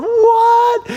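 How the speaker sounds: high-pitched, incredulous exclamation; astonished. Acted. Onlookers seeing the dead man walk out, astonished. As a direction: squeal it in mock disbelief. Crowd's amazement acted out.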